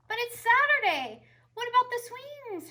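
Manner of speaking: put-on high, loud kid's complaint; little brother protesting. A narrator's reading. Brother calls after Tilly, high and loud. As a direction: wail after his sister. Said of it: "her brother called".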